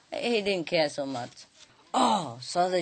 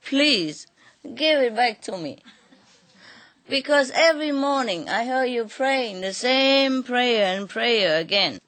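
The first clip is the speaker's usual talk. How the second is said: high, loud, pleading cry; crying plea; 6 semitones higher, 4 dB louder than usual. Acted. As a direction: beg through tears, voice pitched high. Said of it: "but crying".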